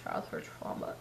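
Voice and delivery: breathy whispered remark, no voice; whispered aside. Real, not acted. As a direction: drop to a secret whisper. Aside after quoting lyric.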